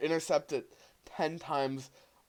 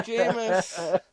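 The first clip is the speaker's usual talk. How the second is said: laughing; 7 semitones higher, 7 dB louder than usual. Real, not acted. Jokey pick of Jameis.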